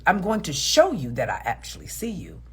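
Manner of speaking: ordinary talk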